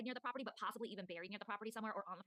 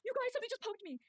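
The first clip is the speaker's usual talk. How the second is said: gasp, then a much higher, louder startled cry; frightened gasp; 13 semitones higher, 6 dB louder than usual. Retold or imitated. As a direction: relive the shock of being poked by something unseen. Poked by ghost, retold.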